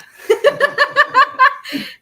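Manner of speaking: loud high burst of laughter; loud squealing laugh; big laugh. Real, not acted. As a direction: crack up out loud. Laughing at her own story.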